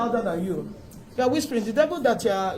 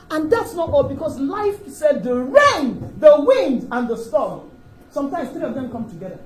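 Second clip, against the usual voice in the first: loud preacher's cry, slow and higher; full-force sermon build; fiery preaching; 6 semitones higher, 8 dB louder than usual. Acted. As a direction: hammer out the wind and the storm to the congregation. Preaching the storm passage.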